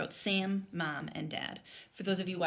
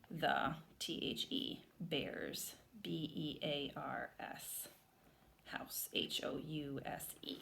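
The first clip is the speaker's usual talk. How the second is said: spelling aloud; 3 semitones lower, 7 dB quieter, slower than usual. Shown as a demonstration. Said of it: "I'm gonna write bear's house".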